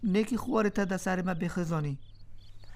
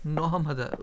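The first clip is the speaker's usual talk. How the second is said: ordinary talk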